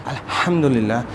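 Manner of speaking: slow الحمدللہ with a heavy airy ح; correct throaty ha. Shown as a demonstration. Teacher corrects a common mistake, slow throaty ha. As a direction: push air through the ح of الحمدللہ. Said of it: "ہم نے اسے کرنا ہے".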